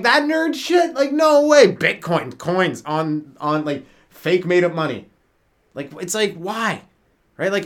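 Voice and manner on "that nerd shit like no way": high, loud mocking scoff; scoffing dismissal. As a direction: laugh it off, way up high. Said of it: "I'm like".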